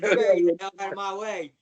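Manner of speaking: loud, fast retold demand; defiant demand. Wrestler ordering the man off his path, defiant. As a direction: shove him aside with the words, loudly.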